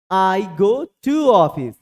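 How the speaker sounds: very slow, word by word; slow teacher drill for the class; slow drill phrase. Shown as a demonstration. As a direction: spell out each word for beginners. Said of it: "आपको ऐसे command करना है".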